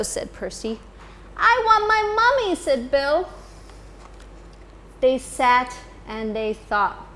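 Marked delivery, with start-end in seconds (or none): 1.4-2.5 high, fast, louder little voice; baby owl whine. Acted. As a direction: rush it out like a fretting baby owl. Baby Bill cries for mummy.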